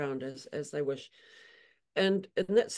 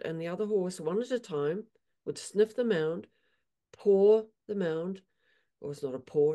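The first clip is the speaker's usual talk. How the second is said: usual speaking voice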